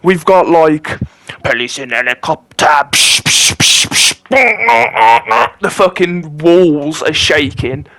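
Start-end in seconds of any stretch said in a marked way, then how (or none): 2.7-5.6 unvoiced "psh" hits; imitating dubstep thumps; mock bass drop noise. Imitating bass and sirens at party.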